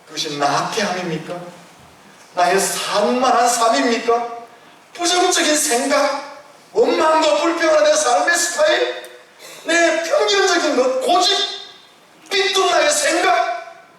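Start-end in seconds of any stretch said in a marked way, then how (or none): 2.3-13.9 climbing list, pitched high; fervent sermon list; preaching at full force. Acted. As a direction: pile up each sin higher.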